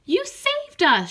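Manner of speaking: overjoyed frog cry; grateful shout. Acted. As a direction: shout it in relief. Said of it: "shouted the frogs".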